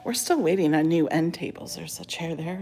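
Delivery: normal talking voice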